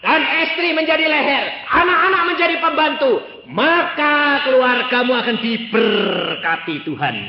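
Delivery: loud, high sermon proclamation; full-force preaching. Acted. Preacher exhorting the congregation on family order, loud and high. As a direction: preach it out to the whole hall.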